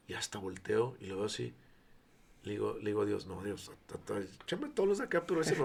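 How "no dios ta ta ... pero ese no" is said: high, half-laughing plea; laughing protest. Retold or imitated. Pastor retells refusing God's choice of the drugged man, laughing. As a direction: beg off it with a nervous laugh. Refusing God, amused panic.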